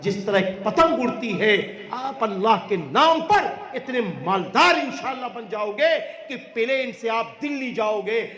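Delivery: ordinary talk, nothing marked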